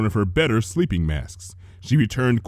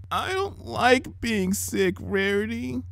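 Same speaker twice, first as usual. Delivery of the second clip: put-on high, soft, slow sick-child whimper; sick little sister whimpering to Rarity; pathetic and miserable; 11 semitones higher, 6 dB quieter, slower than usual. A narrator's reading. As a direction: sound small and heartbroken. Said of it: "pathetic and miserable and heartbreaking".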